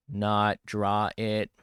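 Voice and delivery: loud and slow, each word landed firmly; emphatic declaration. Real, not acted. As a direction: declare it loudly and slowly like an obvious answer. Answering own question, emphatic.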